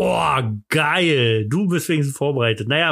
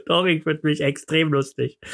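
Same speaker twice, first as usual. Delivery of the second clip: ordinary delivery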